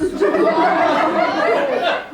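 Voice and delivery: airy high laugh; breathy laugh. Real, not acted. Laughs at own story.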